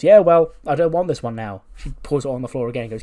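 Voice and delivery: loud, high petulant refusal. Acted. Alexa rejecting the coffee Ivy just brought, petulant. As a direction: push back loudly like a spoilt diva.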